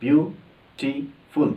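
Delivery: Beautiful slowly split into three beats, ब्यू-टी-फुल; syllable by syllable. Shown as a demonstration. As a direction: break beautiful into three separate syllables with gaps. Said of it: "इसके अंदर तीन वॉल sounds हैं".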